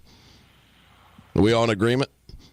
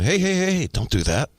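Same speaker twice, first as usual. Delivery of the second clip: put-on sharp high call of hey to a kid, easing off on the rest; retold call to stop a kid messing with a cart; 9 semitones higher than usual. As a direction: snap a warning across the aisle at a child. Scolding a stranger's kid in store.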